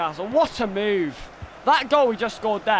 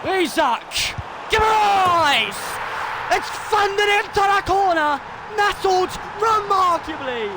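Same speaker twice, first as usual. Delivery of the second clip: high, stretched-out play-by-play shouting; ecstatic goal call; 10 semitones higher than usual. Real, not acted. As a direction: shout the goal home, drawing out the words. Calls a goal live.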